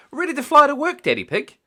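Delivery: high loud perky pilot voice; Miss Rabbit. A narrator's reading. Miss Rabbit ready in her helicopter, high and loud. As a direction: ask it brightly as Miss Rabbit. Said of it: "asked Miss Rabbit".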